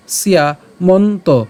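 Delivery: সিয়া মন্ত slowly, "si-ya" then "monto"; ya-phala as iya. Shown as a demonstration. Teacher explains the ya-phala, সিয়া মন্ত slow in parts. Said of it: "উচ্চারণটা কেমন হবে".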